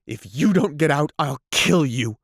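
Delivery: screaming in madness; man screams threats at her; frantic threats. A narrator's reading. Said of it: "he cried".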